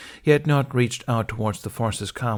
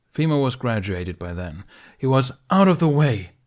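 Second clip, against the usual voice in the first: louder, higher angry interruption; breaking in angrily; 4 semitones higher, 6 dB louder than usual. Acted. Ben breaking in on Qui-Gon, loud and angry. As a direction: cut across him with heat. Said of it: "Ben broke in".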